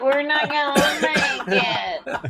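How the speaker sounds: slow, strained whine of a plane; self-doubting plane. Retold or imitated. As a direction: voice a plane doubting itself, drawn out. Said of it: "that's a plane that doubts itself".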